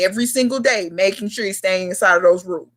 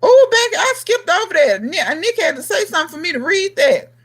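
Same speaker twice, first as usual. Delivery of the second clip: exclaiming apology; 10 semitones higher than usual. Real, not acted. Host to Becky over a skipped comment, high exclaiming apology. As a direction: exclaim at having missed someone, pitch way up.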